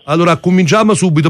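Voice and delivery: ordinary delivery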